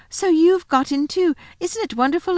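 high, bright call of delight; excited cry. A narrator's reading. Lucy overjoyed to find Edmund in the wood, high excited cry. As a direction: burst out with joy at seeing him. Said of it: "she cried".

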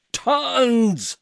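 a loud, high, furious roar; king's roar. A narrator's reading. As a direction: bellow it like an enraged king. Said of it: "roared the king".

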